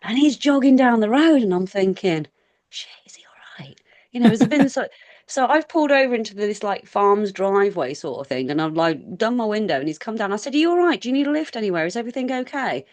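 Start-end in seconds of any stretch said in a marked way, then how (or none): 2.7-3.8 whispered aside to oneself; very quiet, voiceless whisper; hushed inner thought. Retold or imitated. Retold worried thought to self. 10.6-12.8 higher-pitched retold check on a stranger; raised-pitch, concerned questions; concerned offer of help. Retold concerned call from car window.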